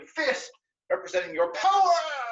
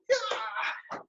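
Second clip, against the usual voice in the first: very high cheering yeah; silly clown; 11 semitones higher than usual. Real, not acted. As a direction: squeal with delight. Cheering on jumping jacks.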